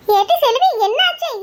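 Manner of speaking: sharp, loud call of her name; loud worried call. Acted. Mother calls Selvi, loud and worried.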